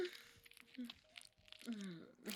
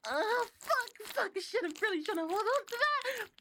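high, loud orgasmic cries; building to a screaming climax; 13 semitones higher, 7 dB louder than usual. Acted. Climax, gonna come, gonna scream.

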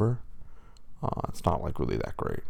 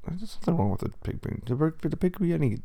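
usual speaking voice

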